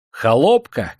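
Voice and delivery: high, loud, haughty challenge; yelled question. A narrator's reading. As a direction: challenge the servant imperiously. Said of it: "заорал дворянчик". (надменный окрик)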